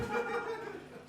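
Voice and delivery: high-pitched helpless giggle. Real, not acted. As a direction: break into a squeaky laugh. Narrator breaks up.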